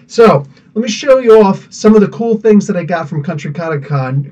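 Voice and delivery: ordinary delivery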